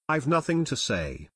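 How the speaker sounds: usual speaking voice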